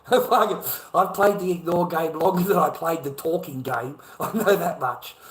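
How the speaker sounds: laughing loud through the words; bitter laugh. Real, not acted. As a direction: laugh it out, exasperated. Bitter laugh over ignore game.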